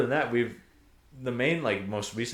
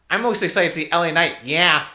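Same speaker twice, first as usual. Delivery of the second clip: raised, loud excited nerd fan; nerdy voice; 7 semitones higher, 9 dB louder than usual. Retold or imitated. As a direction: gush like an over-keen wrestling nerd. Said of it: "had, like, that nerdy voice".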